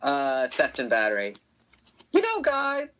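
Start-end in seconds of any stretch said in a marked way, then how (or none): 2.1-2.9 high-pitched character voice; Suitcase voice. Acted. Actor trying a fan-requested line, Suitcase voice. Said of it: "think of a suitcase line".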